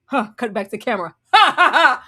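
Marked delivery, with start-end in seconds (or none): 1.3-2.0 loud, hammed-up ha ha ha; mock staged laugh. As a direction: parody a scripted laugh. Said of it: "we throw our head back and we laugh".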